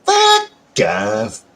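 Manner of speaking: stretched braying donkey call; a hee-haw braying version. Shown as a demonstration. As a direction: bray it like a donkey. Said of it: "The donkey call".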